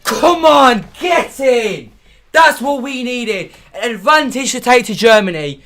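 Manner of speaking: a fan celebrating once the goal is given; relieved celebration. Real, not acted. Goal stands after offside check.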